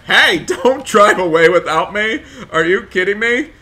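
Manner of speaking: high, loud protest with a laugh; laughing indignant shout. Acted. Shouting at friends in the van not to leave her. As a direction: call after them, half laughing, half outraged.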